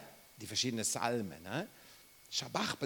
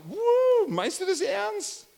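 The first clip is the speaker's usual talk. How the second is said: high, much louder, excited cry; thrilled disbelief; 9 dB louder, slower than usual. Pretend. Thanking the giver of a sports car, thrilled shout. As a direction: burst out in delight, can't believe it. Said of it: "wollen wir da".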